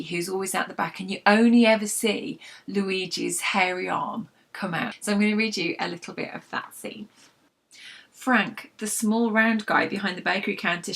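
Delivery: ordinary delivery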